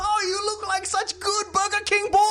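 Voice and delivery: put-on very high, delighted shout, Chinese accent; excited Chinese-accented dad. Dad praising son's Burger King role, thrilled accented shout. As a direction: gush loudly as a proud Chinese father. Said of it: "he's never this excited".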